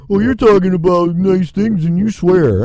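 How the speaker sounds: mock a pearl-clutching listener; mocking critic.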